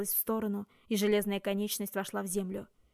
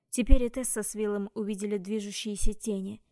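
ordinary delivery

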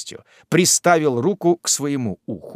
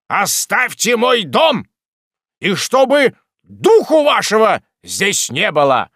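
loud angry command; furious order; 7 semitones higher, 6 dB louder than usual. A narrator's reading. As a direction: throw them out of the house. Magistrate throws them out.

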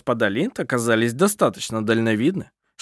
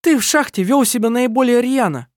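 angry accusation; 11 semitones higher, 4 dB louder than usual. A narrator's reading. Kerstin accuses Adrian.

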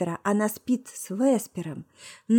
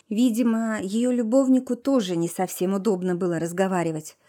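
usual speaking voice

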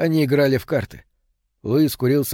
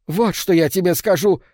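higher, louder threatening cry; shout; 6 semitones higher, 3 dB louder than usual. A narrator's reading. Old woman lunging with a knife, shouting. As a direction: throw it at him as she lunges. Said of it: "крикнула старуха".

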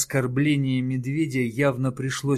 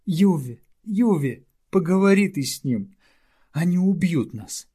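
pleading; 7 semitones higher, 5 dB louder than usual. Acted. Small creature begs for help.

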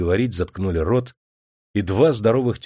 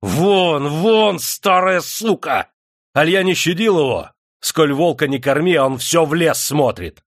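fierce, much higher and louder; furious shout; 7 semitones higher, 4 dB louder than usual. A narrator's reading. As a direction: rage at the kneeling serf woman. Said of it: "закричал люто". (яростный крик)

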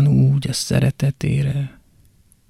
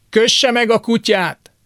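put-on high-pitched old woman's bark; lightly voiced old woman ordering him off; sharp old woman; 9 semitones higher than usual. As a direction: snap it like an annoyed neighbour. Old woman's retort in poem.

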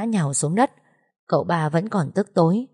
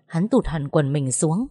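ordinary delivery, nothing marked

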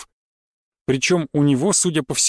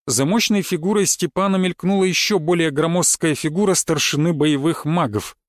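ordinary delivery, nothing marked

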